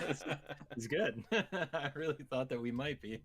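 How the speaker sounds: laughing through the words after being fooled. Real, not acted. To his co-host who fooled him about being live, laughing. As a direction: chuckle it out, half embarrassed at falling for the joke.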